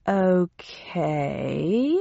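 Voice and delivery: drawn-out, puzzled rise; slow puzzlement. A narrator's reading. Narrator answering news of a pressing problem, slowly and very puzzled. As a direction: stretch it slowly into a baffled question. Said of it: "I said slowly and in great puzzlement".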